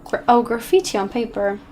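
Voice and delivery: usual speaking voice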